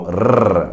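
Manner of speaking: rrr trilled with the tongue tip; rolled R. Shown as a demonstration. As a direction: roll rrr at the front of the mouth. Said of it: "the R is being rolled".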